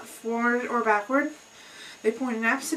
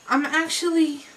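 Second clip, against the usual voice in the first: ordinary delivery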